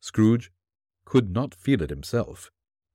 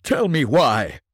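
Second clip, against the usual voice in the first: trembling; 5 semitones higher, 4 dB louder, slower than usual. Acted.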